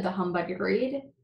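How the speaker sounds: usual speaking voice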